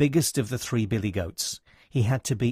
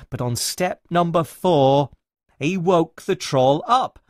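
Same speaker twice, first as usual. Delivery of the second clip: ordinary delivery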